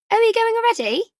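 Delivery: high, loud fake-sweet query; sugary posh mum. A narrator's reading. Nora catching Ellie sneaking off, sugary.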